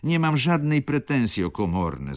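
the baroness begs the lodgers to leave, in a high, exclaiming voice; baroness calling out. A narrator's reading. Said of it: "zawołała baronowa".

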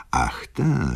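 ordinary delivery, nothing marked

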